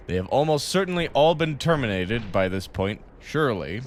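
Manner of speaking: formal corporate briefing read; measured game narration; briefing read-aloud. Acted. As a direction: read it out like an official company notice.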